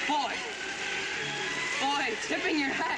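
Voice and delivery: high, loud, elated; overflowing with joy; joyful. Real, not acted. Teacher rejoices as the girl finally understands.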